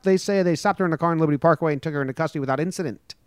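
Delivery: rapid-fire read, barely breathing; speed reading. Shown as a demonstration. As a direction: race through the article fast. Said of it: "I'm gonna speed read this one".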